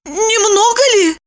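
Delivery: high, hesitant woman's voice; doubtful. A narrator's reading. Woman eyes the large vodka portion, high doubtful voice. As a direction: question it high, unsure. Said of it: "усомнилась Липочка".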